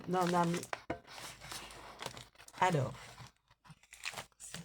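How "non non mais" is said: ordinary delivery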